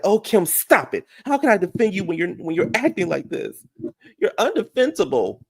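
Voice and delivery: high-pitched imitation of a distraught complainer; put-on high voice, pleading and scolding; mock exasperated protest. Crying sister protesting to Kim's behavior, mock exasperation. Acting Kyle's tearful protest.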